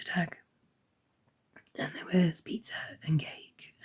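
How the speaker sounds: real croak, mostly whispered; hoarse, sick throat straining; croaky voice. Real, not acted. Telling viewers she's still croaky and unwell. Ill, voice failing throughout.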